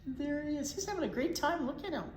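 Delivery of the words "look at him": usual speaking voice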